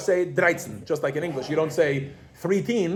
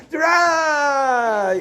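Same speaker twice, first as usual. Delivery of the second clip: Drei! shouted high and loud; excited child shout; 9 semitones higher, 5 dB louder than usual. Retold or imitated. Mother imitating her toddler, joyful shouted Drei!. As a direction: yell Drei! like a thrilled toddler. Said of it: "She just says".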